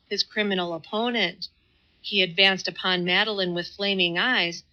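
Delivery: ordinary delivery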